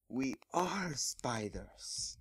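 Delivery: slow, breathy whispered insistence; slow whispered spider. Acted. Big Mac Spider reminding Twilight, slow and whispered. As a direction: draw it out in a hushed, eerie whisper.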